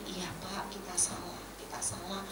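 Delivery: soft, submissive admission; humble apology. Acted. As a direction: give in quietly to an angry creditor. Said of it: "Lek kita tuh rendah hati".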